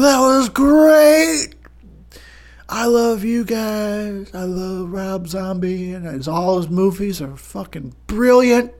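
put-on high, slow drunken gushing; pretend drunk. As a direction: slur out exaggerated love for everyone. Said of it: "gonna be so drunk".

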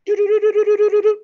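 high, loud, even rapid beeps; imitated alarm beeping. Camper mimicking the perimeter alarm, shrill repeated beeping. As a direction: trill it like an electronic alarm. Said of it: "perimeter alarm go off".

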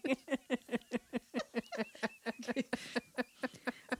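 long, soft run of laughter; helpless giggling. Real, not acted. Co-host cracking up at a private gag, helpless giggling. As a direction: keep giggling, unable to carry on. Hosts crack up mid recap.

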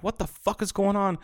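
high-pitched bewildered question; baffled disbelief. Retold or imitated. Retelling confusion over the noisy truck, high bewilderment.

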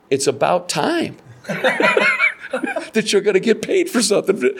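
high-pitched wifely retort with laughter; amused exasperation. Retold or imitated. Wife tells husband about getting paid, amused exasperation. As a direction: deliver the wife's punchline high, laughing. Retold wife's punchline, laugh heard.

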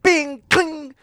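high loud "bing, cling" spring noises; loud high-pitched mock pinging; imitated spring ping. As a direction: ring out the spring sounds. Mimics spring sound again.

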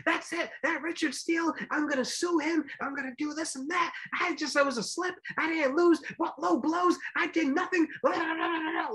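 mock the sore loser crying foul; whiny sore loser Chavez. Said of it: "he became a big baby about it".

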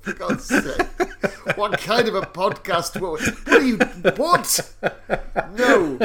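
a laughing what-are-you-on protest; laughing disbelief. Real, not acted. Laughing in disbelief at his co-host's joke.

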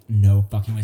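loud, stunned disbelief. Retold or imitated. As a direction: blurt it out in shock. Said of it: "So I was just like".

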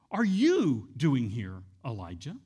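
"you" hit slow and loud; stress on YOU. Shown as a demonstration. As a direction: punch the word "you", slowly. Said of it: "what word to emphasize".

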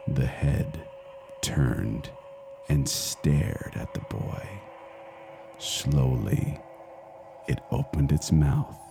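soft, slow, breathy storytelling; hushed suspense. A narrator's reading. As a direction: draw them in with a hush. Horror story build-up.

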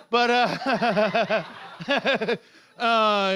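ordinary delivery

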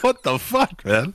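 high, laughing disbelief; disbelieving. Retold or imitated. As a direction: squeal out a laughing what the fuck. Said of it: "I'm like".

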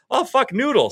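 sudden high yelp of surprise; startled shriek of recognition; surprised outburst. Retold or imitated. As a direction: blurt it out, pitch jumping way up. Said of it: "and I was like".

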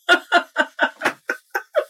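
real amused laugh; high breathy laugh. Real, not acted. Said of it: "I'm laughing".

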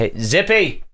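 calling someone into the room. Real, not acted. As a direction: summon someone over. Calling the child into the studio.

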